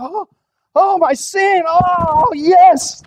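high, put-on swoon over sin; mock lingering fondly over the sin; a hammed-up lover's sigh. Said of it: "They say".

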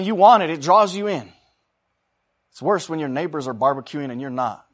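ordinary talk

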